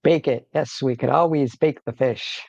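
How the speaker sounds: ordinary talk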